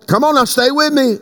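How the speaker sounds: ordinary delivery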